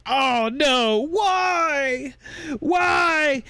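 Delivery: high, hammed-up anguished wail; wailing movie protagonist. Mocking a hero over his murdered girl, melodramatic wail. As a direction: stretch each cry out high in mock grief. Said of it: "the protagonist just sits there and goes".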